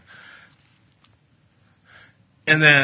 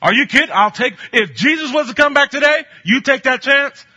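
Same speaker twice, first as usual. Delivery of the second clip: higher and louder disbelief; preacher challenging his listeners, incredulous; incredulous challenge; 12 semitones higher, 9 dB louder than usual. Real, not acted. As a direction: press them hard. Preacher challenges congregation loudly.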